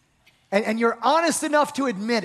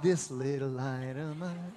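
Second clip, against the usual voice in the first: small meek voice; 8 semitones lower, 12 dB quieter than usual. Retold or imitated. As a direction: lower and much softer mock singing. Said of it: "mocking the small light".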